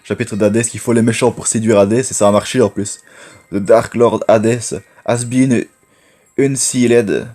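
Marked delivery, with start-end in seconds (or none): 3.5-7.3 slow, careful reading in a French-accented English; deliberate, word-by-word English accent; attempted English accent. Real, not acted. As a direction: read it out slowly, labouring over the English accent. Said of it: "on ne parle pas mon accent".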